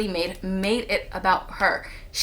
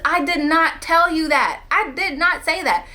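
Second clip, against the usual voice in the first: heated denial; 8 semitones higher, 5 dB louder than usual. Retold or imitated. Denying to her friend that she said it, heated. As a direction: push back hard, each word firm.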